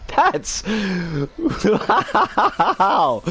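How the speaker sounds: laughing in disbelief; exasperated laugh. Real, not acted. Too angry to do anything but laugh, a disbelieving laugh on wow. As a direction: laugh because the only other option is screaming. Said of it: "I literally have to laugh".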